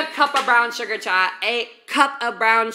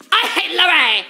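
mock outburst; 7 semitones higher, 5 dB louder than usual. Outburst about feud.

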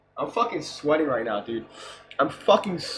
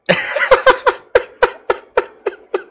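much higher, louder laughing fit; shrieking laugh; 9 dB louder than usual. Real, not acted. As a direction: crack up completely.

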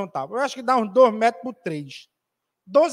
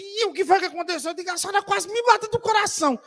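much higher-pitched mock alarm; high, hammed-up fright; retold startled exchange; 9 semitones higher, faster than usual.

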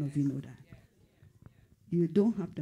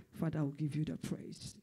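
ordinary talk

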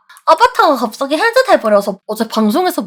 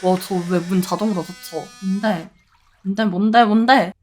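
ordinary talk

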